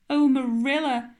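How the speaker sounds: lightly sobbed lament; sobbing. A narrator's reading. Anne sobbing to Marilla over the ruined cake. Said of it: "sobbed Anne".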